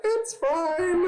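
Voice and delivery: very high, strained insistence; strained fake nonchalance. Dad pretending to ignore his son's swearing, strained nonchalance. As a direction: insist all is well in a squeaky pitch.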